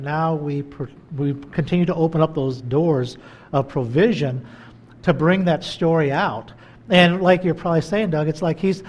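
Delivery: ordinary talk, nothing marked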